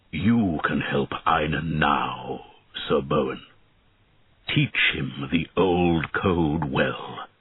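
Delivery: slow, low and grave dragon voice; an ancient dragon laying down a vow; solemn dragon. Acted. Dragon's last words to knight.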